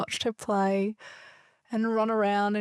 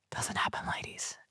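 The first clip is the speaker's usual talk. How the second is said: quick confiding whisper; whispered aside; 8 dB quieter than usual. Real, not acted. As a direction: let the ladies in on a secret.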